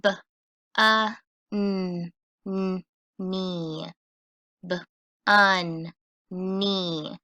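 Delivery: B-un-knee split into its sounds; sounding out. Shown as a demonstration. Teacher sounding out a word for the class, slowly. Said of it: "Let's try it out".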